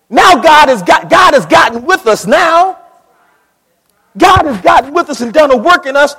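loud, high preaching cry; full-force preaching. Acted. Preacher lifting his congregation in a loud high cry. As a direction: preach it out with rising fire.

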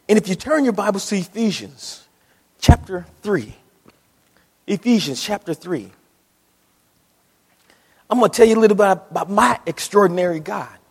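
ordinary talk, nothing marked

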